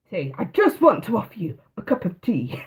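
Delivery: hint of a posh upper-class voice; lightly plummy, laughing through it; posh Eton voice. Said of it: "In his posh, snobby Eton voice".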